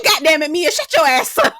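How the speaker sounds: loud mock yell breaking into laughter; retold exasperated yell. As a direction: yell it as a fed-up crowd, cracking up. Repeats table's outburst, laughing.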